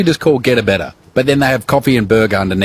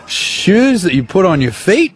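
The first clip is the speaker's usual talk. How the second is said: slow, giggling through the words; drawn out with laughs; laughing; 6 semitones higher, slower than usual. Real, not acted. Laughing while spelling out shoes.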